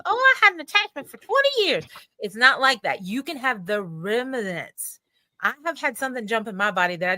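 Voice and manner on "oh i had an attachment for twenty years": high, mocking put-on voice; mock claimant voice. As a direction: parody someone overstating it. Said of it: "somebody that says".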